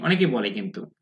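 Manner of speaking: ordinary talk, nothing marked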